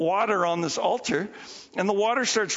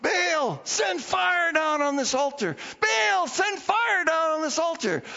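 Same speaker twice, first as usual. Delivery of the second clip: crying out to Baal; 8 semitones higher than usual. Retold or imitated. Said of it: "they start crying out to Baal".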